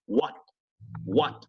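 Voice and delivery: "What" with the tongue stopping on an unreleased T; "What" ending on a silent, held T; swallowed final T. Shown as a demonstration. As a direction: say "What" American style, choking off the final T. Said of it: "el sonido se ahoga al final".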